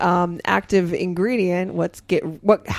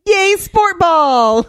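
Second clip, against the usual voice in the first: mock cheer; 8 semitones higher, 3 dB louder, much slower than usual. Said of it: "mocking sports talk".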